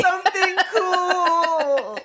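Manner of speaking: high, slow, stretched-out call; mock action one-liner. Echoing the hero's grenade line, in a high drawn-out mock call. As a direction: draw out the last word high.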